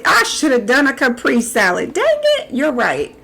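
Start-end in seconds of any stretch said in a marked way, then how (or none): 1.9-2.5 squeaky high dang. Pretend. Squeaky exclamation.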